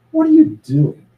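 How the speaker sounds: put-on an old cynic calling out to the boy; man on the beach demanding an answer from the boy; cynical old man. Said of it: "This cynical old man".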